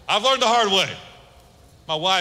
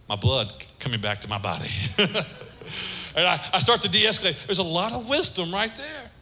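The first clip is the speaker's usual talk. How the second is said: normal talking voice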